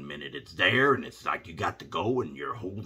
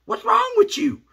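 hammed-up wife, much higher and louder; mock wife voice; 10 dB louder than usual. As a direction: squeak it out like his suspicious wife. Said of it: "the wife is like".

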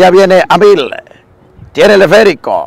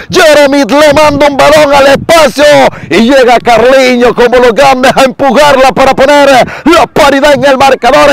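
excited commentary; 6 semitones higher than usual. Real, not acted. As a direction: still riding the goal high. Replay of the goal.

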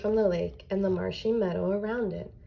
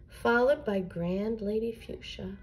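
ordinary delivery

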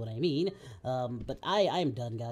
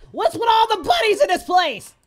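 higher, loud disbelief; incredulous shout; 10 dB louder than usual. Real, not acted. Gamer shouts about all the toys in the house, incredulous. Shocked at many dildos.

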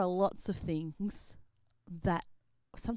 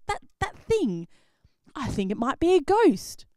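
scared disciple; 7 semitones higher, 10 dB louder than usual. Acted. Disciple spots a ghost.

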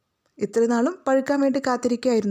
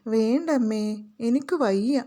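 usual speaking voice

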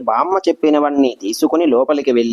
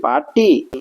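loud call; 9 semitones higher than usual. A narrator's reading. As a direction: call her name out high. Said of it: "గట్టిగా అరుస్తున్నందుకు". (బామ్మను గట్టిగా పిలవడం)

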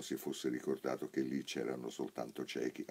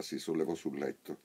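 usual speaking voice

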